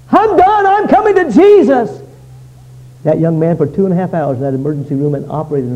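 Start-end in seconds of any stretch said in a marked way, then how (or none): 0.1-2.0 very high, loud cry of surrender; shouted vow. Retold or imitated. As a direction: cry out the decision at full pitch. Young man's vow repeated as a cry.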